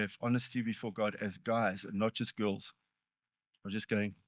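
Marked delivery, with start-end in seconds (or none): none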